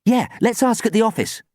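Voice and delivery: high, firm agreement; brisk, resolute proposal; decisive. A narrator's reading. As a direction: settle it decisively. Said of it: "said Sam decisively".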